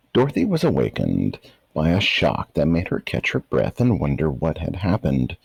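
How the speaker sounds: usual speaking voice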